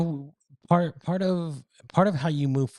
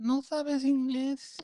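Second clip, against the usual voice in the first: put-on much higher, slow old Spanish lady; 13 semitones higher, 4 dB quieter, much slower than usual. Old lady feigning no English to the caller, high slow voice. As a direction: drag it out like a puzzled abuela. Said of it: "I'd act like I was an old lady".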